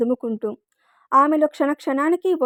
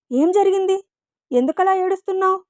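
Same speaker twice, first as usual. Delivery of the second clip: anxious questions pitched higher; worried; 6 semitones higher than usual. A narrator's reading. Husband anxiously asks his crying wife, higher pitched worry. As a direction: ask urgently, alarmed. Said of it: "కంగారుగా".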